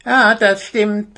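high, slow little hare voice; little hare backing up his friend; confirming. A narrator's reading. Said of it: "bestätigte nun auch Schnuffi".

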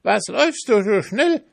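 high, loud crowing rooster. A narrator's reading. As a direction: call out like a rooster. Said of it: "fragte der Gockel".

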